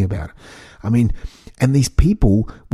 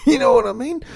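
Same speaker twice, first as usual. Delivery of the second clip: laughing; 3 dB quieter, faster than usual. Real, not acted. Laughs after his impression.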